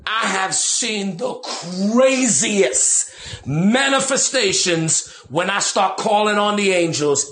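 ordinary delivery

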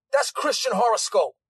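usual speaking voice

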